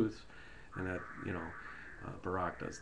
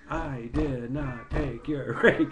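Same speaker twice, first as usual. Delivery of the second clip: mock indignant denial; 4 semitones higher, 6 dB louder, much slower than usual. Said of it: "And he says".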